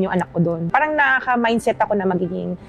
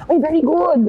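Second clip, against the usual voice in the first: higher-pitched imitation of a pleased professor; impressed professor; 8 semitones higher, 3 dB louder than usual. Student imitating her professor's praise after an accented report, high and approving. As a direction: exclaim approval like a professor, voice lifted. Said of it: "ng professor ko".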